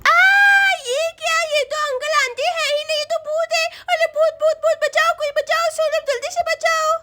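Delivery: put-on high, lisping child crying for help; panicking little Chinki. Girl realises aunty is a ghost, panicked child voice. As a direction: squeal it as a terrified small girl.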